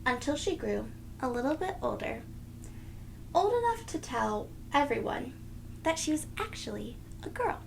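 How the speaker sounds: ordinary delivery, nothing marked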